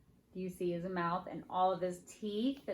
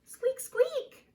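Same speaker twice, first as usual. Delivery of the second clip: tiny, very high chick squeaks; piercingly high little squeak voice; slower than usual. A narrator's reading. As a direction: squeak like something inside an egg. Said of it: "More squeaking".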